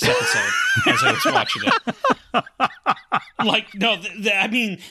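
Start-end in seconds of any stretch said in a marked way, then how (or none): 0.0-2.4 laughing. Real, not acted. Laughs while complaining.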